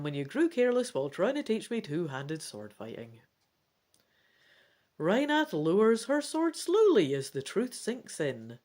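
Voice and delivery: animated read-aloud storytelling; story narration. A narrator's reading. Reads gamebook narration aloud.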